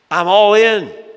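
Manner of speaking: loud, high burst of bravado; emphatic pledge. Acted. Peter vowing loyalty to Jesus, emphatic pledge. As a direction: shout it as an all-out pledge.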